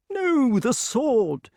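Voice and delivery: high, drawn-out correction; King Pellinore. A narrator's reading. Pellinore corrects Grummore, high and exasperated. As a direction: correct them in a high, slow voice.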